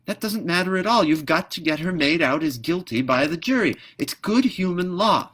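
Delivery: high, loud sharp snap; snapping. Acted. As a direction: cut him off with a sharp high retort. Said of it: "snapped the swallow".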